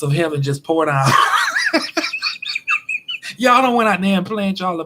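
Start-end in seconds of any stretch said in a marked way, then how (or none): 1.1-3.3 high laugh. Real, not acted. Laughing at rainy forecast.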